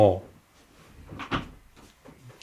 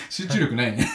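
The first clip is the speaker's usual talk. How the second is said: laughing; 5 semitones higher, 6 dB quieter than usual. Real, not acted. Laughing at own distraction.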